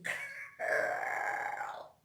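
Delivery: put-on high whispered knowing "Girl."; girls in the back. Girls in the audience reacting, high whispered aside. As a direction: breathe it like a knowing friend. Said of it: "I hear the girls in the back going".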